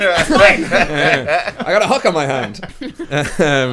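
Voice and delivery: slow, loud through laughter; laughing. Real, not acted. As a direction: giggle the words out slowly. GM laughing at hook joke.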